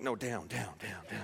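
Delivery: soft, laughing, low hush to herself; half-laughing whispered down down; hushed self-shushing. Pretend. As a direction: press the urge back down under her breath. Hushing the Holy Spirit inside.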